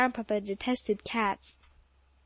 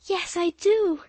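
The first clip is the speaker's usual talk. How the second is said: eager yes; 7 semitones higher, 5 dB louder, slower than usual. A narrator's reading. Girl eagerly answers Polly.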